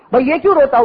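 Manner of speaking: a hint of a flustered wife; lightly acted worried wife. Wife asking her crying husband why, lightly acted alarm.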